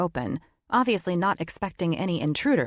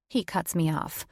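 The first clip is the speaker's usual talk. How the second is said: usual speaking voice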